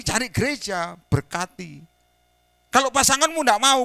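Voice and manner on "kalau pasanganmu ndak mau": 1 forceful preaching. Real, not acted. Preacher exhorting congregation loudly.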